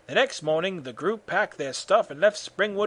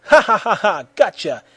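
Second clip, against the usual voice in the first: loud, higher mocking laughter; gloating laugh; 4 semitones higher, 7 dB louder than usual. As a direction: laugh loudly, then crow it. Goku gloats after fooling Freddy.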